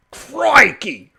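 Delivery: high, loud exclamation in imitation; mock Australian outburst. Imitating an Australian called English, mock outburst. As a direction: burst out as an affronted Aussie. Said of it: "an Australian".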